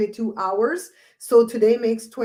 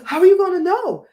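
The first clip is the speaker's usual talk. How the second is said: much higher, louder questioning voice; cousin's question; 10 semitones higher, 7 dB louder than usual. Retold or imitated. Vlogger quoting cousin, pitched high and loud. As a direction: play the curious cousin. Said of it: "she goes".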